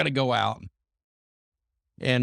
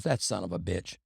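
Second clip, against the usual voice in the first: muttered aside; 5 semitones lower, 6 dB quieter than usual. Retold or imitated. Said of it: "He goes".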